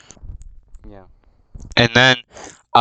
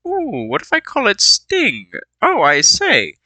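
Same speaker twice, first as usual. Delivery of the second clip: mock delighted Bilbo; 9 semitones higher than usual. Bilbo names his sword Sting, high and pleased. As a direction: coo over the new sword name.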